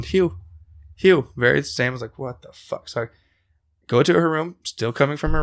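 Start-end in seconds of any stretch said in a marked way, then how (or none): 0.0-1.3 a hint of eerie flat calling, higher; monotone calling. Voice heard on the stairs, eerie flat call. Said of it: "still monotone". 2.2-2.9 whisper. Retold or imitated. Scared whisper on stairs.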